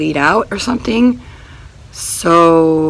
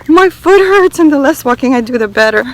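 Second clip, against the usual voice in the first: higher and louder, strained with pain; pained outburst; 6 semitones higher, 7 dB louder than usual. Real, not acted. Walking on her swollen foot, pained and strained. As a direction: let the sore foot pour out.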